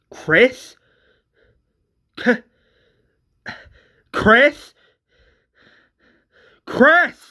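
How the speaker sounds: high, loud rising question; alarmed calling. Acted. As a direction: call the name in growing disbelief. Acted father finding his child dead.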